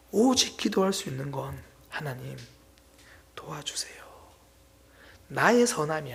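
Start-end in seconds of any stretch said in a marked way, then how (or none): 1.9-4.0 barely voiced, soft prayer to God; hushed plea. A believer praying in need, barely a whisper. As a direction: breathe the words like a desperate prayer.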